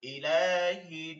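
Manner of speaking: ইলাহিন slowly, the n held long through the nose; slow recitation of ইলাহিন, n held; nasal hold. Shown as a demonstration. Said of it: "তিন আলিফ টেনে পড়তে হবে".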